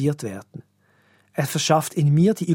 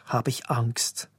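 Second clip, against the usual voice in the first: whispered fear; 4 semitones lower, 6 dB quieter, slower than usual. Real, not acted. Pastor confesses his fear.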